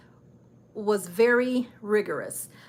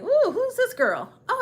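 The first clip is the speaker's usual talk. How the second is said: surprised ooh; 12 semitones higher, 4 dB louder than usual. Retold or imitated. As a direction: act impressed by the photo. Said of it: "I'm like".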